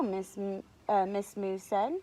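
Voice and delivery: usual speaking voice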